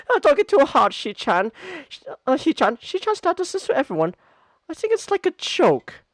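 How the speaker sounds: put-on giggly girl. [name] defends Shizuna, giggly. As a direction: laugh it off lightly.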